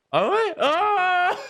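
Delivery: very high, astonished squeal; high-pitched surprise. Real, not acted. Streamer reacting to the accent remark, high surprised squeal. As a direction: react in a soaring, delighted squeal.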